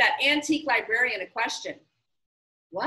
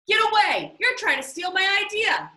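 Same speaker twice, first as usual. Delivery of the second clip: high, loud accusing yell; angry shout; 6 semitones higher, 4 dB louder than usual. Acted. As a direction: snap at him to back off. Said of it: "started shouting at Miguel".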